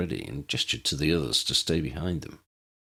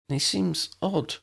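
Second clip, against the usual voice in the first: put-on tentative young woman; 11 semitones higher, slower than usual. A narrator's reading. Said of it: "she ventured".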